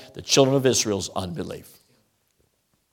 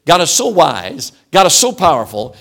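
louder, higher preaching, each "so" hammered; preaching at full force; 3 semitones higher, 6 dB louder than usual. Acted. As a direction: drive the refrain home like a revival preacher. Preacher's climactic refrain.

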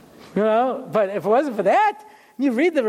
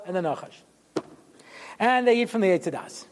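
usual speaking voice

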